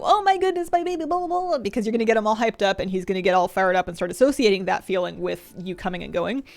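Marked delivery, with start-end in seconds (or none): 0.0-1.6 high, gushing coo at the puppy; overexcited greeting to a dog; gushing baby talk. Retold or imitated. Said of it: "you don't immediately go".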